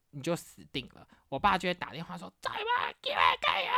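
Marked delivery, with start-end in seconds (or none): 2.4-3.7 angry dad. Retold or imitated. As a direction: snap like a furious father. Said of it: "狂骂脏话".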